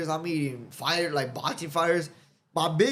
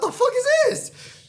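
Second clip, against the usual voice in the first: shocked outburst; 6 dB louder than usual. Retold or imitated. Shocked at hotel delivery fee.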